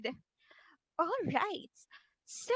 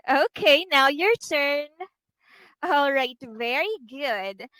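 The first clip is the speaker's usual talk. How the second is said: usual speaking voice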